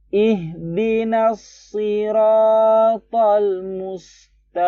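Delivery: high, very slow tilawah; Quran recitation. Shown as a demonstration. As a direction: chant it out slowly and fully. (tilawah tinggi dan sangat pelan)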